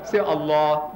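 "Allah" with a doubled l and a rounded final a; the Arabic "Allah", held l; "Allah" said "al-lo", long l; right Allah. Shown as a demonstration. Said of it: "Try, try".

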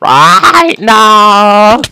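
loud frustrated no; sudden loud protest; shout. Real, not acted. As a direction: yell it out at the screen. Frustrated gaming yelp.

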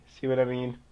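usual speaking voice

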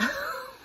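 high mock bully laugh; bully laughing at Pika. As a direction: scoff a short laugh. Brutus laughs in story.

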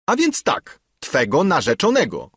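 high, emphatic confirmation; indignant father. A narrator's reading. Father confirms the match to his daughter, indignant.

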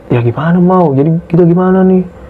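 ordinary talk, nothing marked

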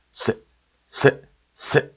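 세 cut short as a single abrupt syllable; separate, quick bursts of 세; deep, abrupt sound. Shown as a demonstration. Teacher demonstrating the old abrupt vowel, 세 clipped short. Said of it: "이런 소리가 되겠죠".

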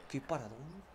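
very soft, awed aside; barely voiced marvelling; hushed amazement. Real, not acted. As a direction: say it under your breath in wonder. Marvelling at the save.

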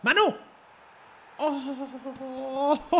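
high, loud yell into laughter; excited shout. Real, not acted. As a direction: shout for joy, then laugh. Goal celebration.

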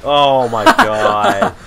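slow, loud, high groan; drawn-out exasperated yell. Real, not acted. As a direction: stretch it out in disbelief. Another disaster in game.

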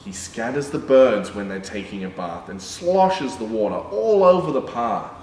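sing-song picture book reading; reading to children. A narrator's reading. Reading a rhyming dog book to children, sing-song. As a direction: read the rhyme to little kids.

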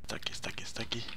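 hushed urgent whisper. Real, not acted. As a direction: whisper it so nobody hears. Acechando a un enemigo cerca.

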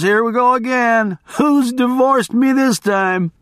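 high-pitched, worked-up barroom storyteller; boisterous guy telling his wild tale; rambling race fan. Acted. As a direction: hold forth to a crowd in a high voice. Voices rambling biker to crowd.

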